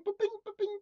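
high, soft teacup pings; tiny high-pitched clink noises; ping lightly like a spoon on china; imitated spoon clinking.

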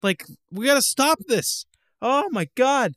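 high, loud exasperated outburst; getting worked up about sequels; heated rant. Real, not acted. Ranting at Far Cry fans, heated high outburst. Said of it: "I'm getting, getting a little heated".

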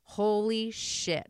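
slow amazed. Retold or imitated. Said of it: "she's like".

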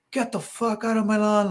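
high-pitched mock angry homeowner; high, indignant order to leave; retold hostile outburst. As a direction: imitate an irate man without shouting. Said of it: "he just started going at me".

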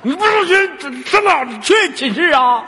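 high, loud, hammed-up outrage; mock-angry grilling. Host catching a caller out over the dorm, mock-angry. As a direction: challenge them with exaggerated indignation.

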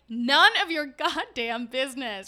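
loud indignant snap; angry. Retold or imitated. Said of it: "I'm like".

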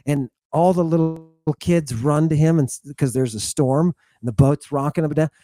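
ordinary talk

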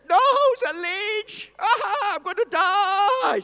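high, slow, hammed-up wail; panicked girl. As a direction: wail like she is about to die. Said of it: "And she said".

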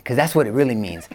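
normal talking voice

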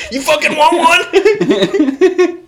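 high, slow, laughing mock goon; hammed-up tough-guy taunt. Taunting a cohost as a mock hockey goon, laughing. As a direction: stretch the threat out like a cartoon enforcer.

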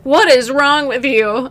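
loud, lightly voiced alarmed pet; imitated frantic dog. Dog losing her mind at her owner, imitated alarm. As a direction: demand it loudly as the baffled dog. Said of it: "she's losing her mind".

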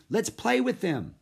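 high-pitched, playful urging; excited girl's lifted voice; eager cry. A narrator's reading. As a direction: beg to join the fun. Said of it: "cried Annie".

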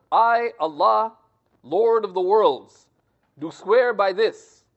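slow, weighty "I, Allah, Lord of the worlds", a pause after each phrase; solemn spelled-out oath. Shown as a demonstration. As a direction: intone it as a grave declaration. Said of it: "you could put".

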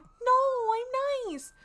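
put-on squeaky high girlish protest; high mock sweet voice. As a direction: pipe it up high like a sweet innocent girl. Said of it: "And she's like".

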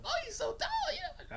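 very high falsetto cooing; excited girls. Retold or imitated. Girls looking up at the bird, cooing high. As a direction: gush softly in a mock girlish squeak. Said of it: "and they're like".

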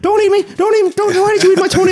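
very high, squealing mother; shrill mum voice. Retold or imitated. Priest mimics Tony's mother pleading, squeaky falsetto. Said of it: "she's gonna be like".

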